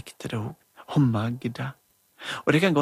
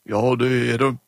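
louder, gruff hallo; gruff doctor voice; 2 semitones lower, 5 dB louder than usual. Acted. Emma as doctor answers phone, gruffly. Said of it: "hun gjorde seg veldig grov i målet".

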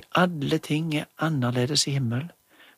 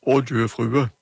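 louder, gruff hallo; gruff doctor voice; 5 dB louder than usual. Acted. Emma as doctor answers phone, gruffly. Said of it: "hun gjorde seg veldig grov i målet".

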